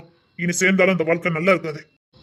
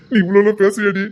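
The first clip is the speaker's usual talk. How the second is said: laughing tease; 4 semitones higher, 7 dB louder than usual. Acted. Husband laughing at wife.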